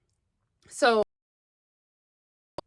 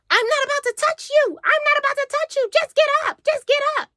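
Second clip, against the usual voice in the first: much higher, squeaky imitation; mock high whiny voice; 3 dB louder than usual. The girl tells Crunk to just get up, squeaky mock voice. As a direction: mimic her pleading to get up.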